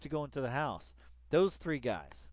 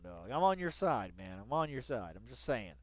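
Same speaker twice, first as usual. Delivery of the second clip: ordinary talk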